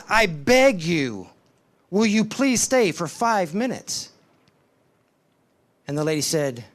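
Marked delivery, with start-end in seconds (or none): 0.1-4.1 slow, louder desperate begging on her knees. Retold or imitated. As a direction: plead slowly, drawing out every word. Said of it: "gets on her knees".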